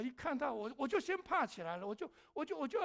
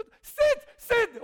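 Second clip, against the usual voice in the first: sit sit barked out loud and slow; loud, slow sit, sit bellowed at a dog; loud command; 11 semitones higher, 14 dB louder than usual. Retold or imitated. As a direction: yell the command so the owner hears. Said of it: "我很大声".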